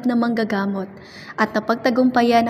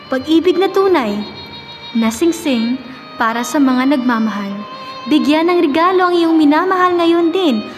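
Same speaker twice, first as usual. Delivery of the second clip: lilting radio commercial voice; polished sales pitch with swinging intonation; ad read; 3 semitones higher than usual. Acted. Reads a ring advertisement.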